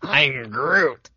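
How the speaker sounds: a light put-on Groot voice. Teasing about a buddy's beard, a light Groot voice. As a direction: lightly voice the tree creature Groot. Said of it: "He's like".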